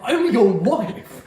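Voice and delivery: slow, high, laughing protest; outraged wife. Retold or imitated. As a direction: plead it with a laugh. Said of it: "reaction from Mia".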